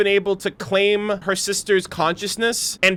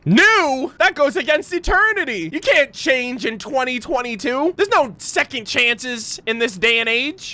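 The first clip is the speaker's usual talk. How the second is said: mock outrage; 6 semitones higher than usual. Mock outrage at game character.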